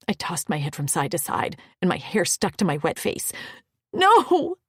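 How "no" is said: high sobbing cry. Acted. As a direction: sob out a desperate no. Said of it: "I sobbed".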